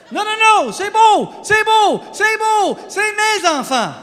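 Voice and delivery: high, loud, frantic bad French; hammed-up panicked French pleading; panicked protest. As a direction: shout it over and over to undo the mistake. Panicked fix with more bad French.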